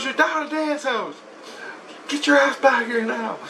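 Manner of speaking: high-pitched imitation of a wife; wife giving orders. Wife tells husband to come home, high mock voice. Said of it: "she goes".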